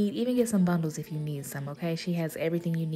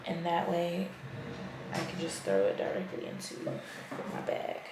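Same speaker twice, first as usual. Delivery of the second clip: barely audible; 10 dB quieter than usual. Real, not acted. Keeps voice low late at night.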